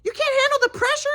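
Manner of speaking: high mocking scold, very high; mock angry mom. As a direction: parody mom's angry scolding. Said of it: "my mom was angry".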